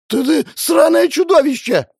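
high, loud terrified shriek; screaming in horror. Acted. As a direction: scream it at the monster. Victim screams at demon.

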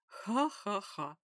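slow, loud gloating villain laugh. Acted. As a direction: laugh slowly in cruel triumph. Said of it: "злорадно рассмеялся он".

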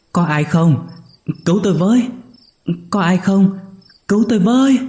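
high, loud cries for help, rising each time; crying for help. A narrator's reading. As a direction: call out desperately for rescue. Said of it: "tiếng ai đó kêu cứu". (kêu cứu thất thanh, ngày càng cao)

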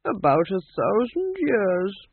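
put-on understated boast from an old king; tinged with pride. A narrator's reading. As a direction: draw it out proudly. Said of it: "his voice tinged with pride".

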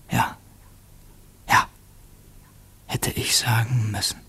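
whispered thought. Acted. Inner thought after unspoken answer.

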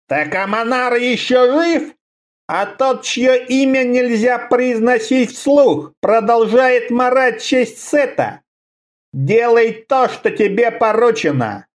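high, slow, menacing creature voice; a distant master threatening through a homunculus; hoarse rattling voice. Acted. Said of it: "голос этот был хриплый и дребезжащим".

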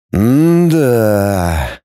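long, drawn-out pondering hum; thoughtful. A narrator's reading. Professor takes in the bad news, thoughtfully. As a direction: mull it over in one stretched sound. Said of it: "задумчиво сказал Амосов".

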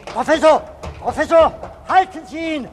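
loud, high urgent shout. Acted. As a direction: yell after someone running away. Policeman shouts after fleeing man.

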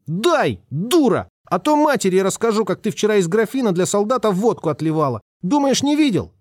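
put-on high, bratty little boy threatening the cook; high-pitched brat, sly and pushy; cheeky boy. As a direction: pipe it up like a spoiled kid blackmailing. Narrator voices the boy Sashka.

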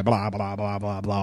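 ordinary talk

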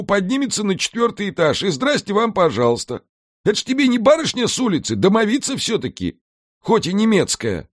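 a scheming house spirit pitching the plan; Alfred the house spirit. A narrator's reading. Alfred to Mika, laying out the island scheme, in a high lively voice.

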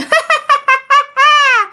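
high-pitched evil cackle; long, high mocking witch laugh; ghostly old woman's laugh. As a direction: cackle like a ghost who has caught her prey. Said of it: "Bà mới cười".

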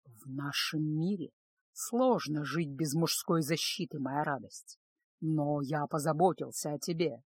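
low, soft, slow assurance; protective lord. A narrator's reading. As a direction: reassure her in a deep, unhurried voice. Lord reassures departing concubine.

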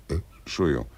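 Entschuldigung said through a burp; a gross belch on Entschuldigung; burping apology. Acted. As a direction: belch the word out, then apologise. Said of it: "rülpsen Sie denn fortwährend so ekelhaft".

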